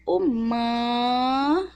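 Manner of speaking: humano stretched very slowly, rising at the end; drawn-out demo word. Shown as a demonstration. Teacher writing humano on the board, sounding it out slowly. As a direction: draw out humano syllable by syllable. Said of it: "H com U".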